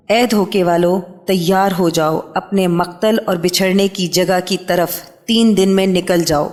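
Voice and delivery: ordinary talk, nothing marked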